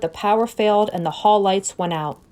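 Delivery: ordinary delivery, nothing marked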